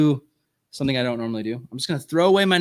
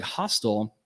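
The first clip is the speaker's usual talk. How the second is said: normal talking voice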